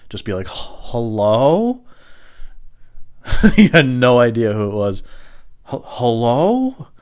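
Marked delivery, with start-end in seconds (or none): 0.5-1.8 slow, loud "hello" rising at the end; wary phone answer. Retold or imitated. Answering a phone completely cold, wary rising "hello". 5.7-6.9 slow, loud "hello" rising at the end; wary phone answer. Retold or imitated. Answering a phone completely cold, wary rising "hello".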